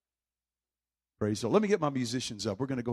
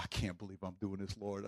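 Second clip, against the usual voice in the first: much softer aside to God; under his breath; 7 semitones lower, 13 dB quieter than usual. Retold or imitated. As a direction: mutter it so nobody hears. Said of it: "say that part under my breath".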